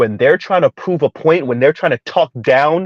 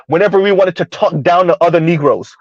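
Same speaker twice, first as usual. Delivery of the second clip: normal talking voice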